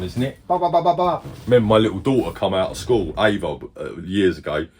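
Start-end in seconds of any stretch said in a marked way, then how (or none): 0.5-1.2 high, quick mimicked running noise; imitated Naruto run sound. Dad mimicking his daughter's Naruto run with high sound effects. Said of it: "the Naruto run".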